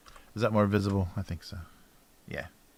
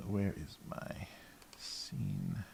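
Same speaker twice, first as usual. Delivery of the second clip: whispering; 12 dB quieter, much slower than usual. Real, not acted. Muttering to self searching files.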